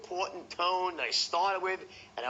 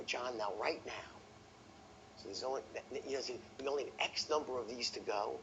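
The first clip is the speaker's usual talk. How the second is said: lowered, quieter voice with urgency; scarcity tonality; 4 semitones lower, 7 dB quieter than usual. Shown as a demonstration. Salesman warning prospect John of scarcity, lowered voice. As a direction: drop your voice as if sharing a secret deadline. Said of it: "when you lower your voice".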